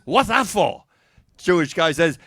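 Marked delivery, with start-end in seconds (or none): 0.1-0.8 lightly put-on Chinese accent; light mock Chinese accent. Chinese guy asks after the punch, light mock Chinese accent. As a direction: question with a hint of caricatured Chinese accent. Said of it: "Chinese guy says".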